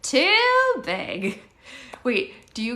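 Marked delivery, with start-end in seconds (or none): 0.0-1.4 put-on little kid's high, loud verdict; Zoe. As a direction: pitch it way up and draw out the first word. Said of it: "I sound like Zoe when I'm imitating her".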